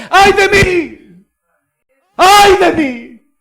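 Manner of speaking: high, loud, slow cry of woe; drawn-out shouted woe; shouted lament. Acted. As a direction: wail it out in despair. Isaiah humbles himself crying out.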